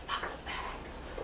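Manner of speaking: whispered "lots of bags" for emphasis; sudden whispered aside. Shown as a demonstration. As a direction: drop to a whisper to make them listen. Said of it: "whispering is also an emphasis".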